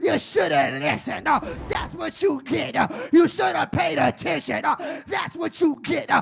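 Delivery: loud sung preacher's whoop with organ; preaching at full force. Acted. Preacher whooping the church about God's rescue. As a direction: whoop it, rising and chanting.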